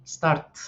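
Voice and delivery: "start" ending in a voiceless T. Shown as a demonstration. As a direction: finish "start" on a puff of T. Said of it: "un sonido de T".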